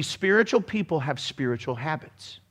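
ordinary delivery

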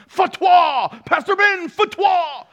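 high, loud mock cry of fatwa; mock outraged shout. Preacher imitates offended viewers calling for his punishment, high mock shout. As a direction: yell it like an imagined angry viewer.

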